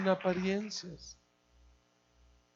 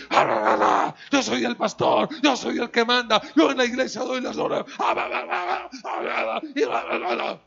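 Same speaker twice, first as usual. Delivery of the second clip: loud, high, hammed-up tirade in the car; loud la-la rant of an arrogant boss; mock angry rant; 7 semitones higher, 9 dB louder than usual. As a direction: play the bossy pastor yelling. Pastor ranting in the car.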